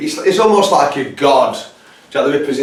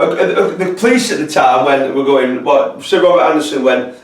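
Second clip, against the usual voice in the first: ordinary delivery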